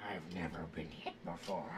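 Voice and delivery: breathless shocked whisper; stunned whisper. Acted. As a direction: whisper it in disbelief. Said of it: "He's like".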